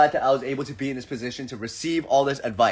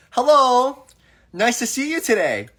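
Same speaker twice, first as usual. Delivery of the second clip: bright greeting; 10 semitones higher, 3 dB louder than usual. Retold or imitated. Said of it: "I said".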